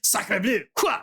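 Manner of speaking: hammed-up French accent; exaggerated French chef exclaiming.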